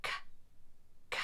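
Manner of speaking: usual speaking voice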